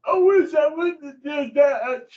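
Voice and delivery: high, crying mock regret; hammed-up tearful wish it never happened; regretful whine. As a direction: whimper like someone caught out. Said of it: "you go".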